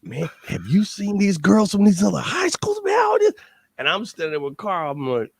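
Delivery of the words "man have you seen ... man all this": high, hyped-up party friends; rowdy buddies. Retold or imitated. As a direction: play the excited crowd of teenage friends. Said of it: "And everybody comes".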